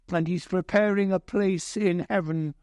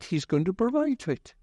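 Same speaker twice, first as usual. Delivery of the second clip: normal talking voice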